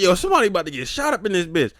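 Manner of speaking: loud, high, fast retold panic; alarmed outburst. Bystander warning as the theft unfolds, alarmed outburst. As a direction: blurt it out in hyped-up alarm.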